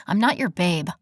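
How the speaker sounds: ordinary delivery, nothing marked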